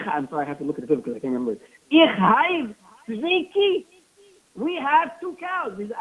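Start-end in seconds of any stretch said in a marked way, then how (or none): 1.9-5.8 slow insistence. Retold or imitated. Nosy man insists to wife.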